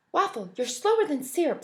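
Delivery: Milk teasing Waffle in line; taunting. Acted. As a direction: tease him sharply. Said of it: "said Milk".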